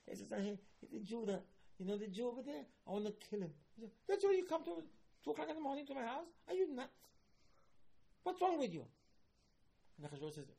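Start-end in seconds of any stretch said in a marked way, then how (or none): 0.0-8.8 much higher voice, shocked and mocking; outraged king. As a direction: play the king, appalled at the late-night visit. Mocking king's outraged reaction.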